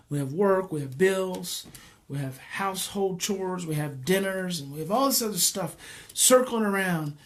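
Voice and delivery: ordinary talk, nothing marked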